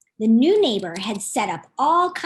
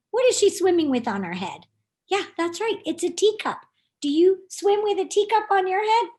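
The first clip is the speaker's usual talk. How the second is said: ordinary delivery, nothing marked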